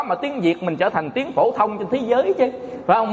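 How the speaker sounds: ordinary talk, nothing marked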